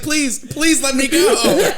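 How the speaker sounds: high, loud, slow whiny pleading; mock begging. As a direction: beg like a kid who wants to go out. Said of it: "You were like".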